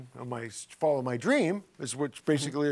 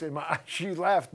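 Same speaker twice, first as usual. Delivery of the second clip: ordinary talk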